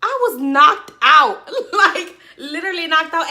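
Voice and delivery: loud, laughing through it; loud, laughing retelling. Real, not acted. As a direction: crack up while telling it. Laughing about sleeping all day.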